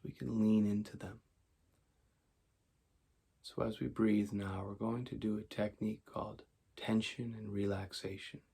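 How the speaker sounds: calm, measured meditation voice with long pauses; guided meditation. Acted. Teacher leading listeners toward sleep, calm guided meditation. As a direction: guide them gently through breath and body.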